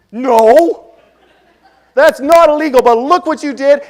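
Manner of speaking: loud, high exasperated protest; exasperated retort. Retold or imitated. Husband arguing with the woman over her parked car, loud and exasperated. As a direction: throw it back at her, fed up.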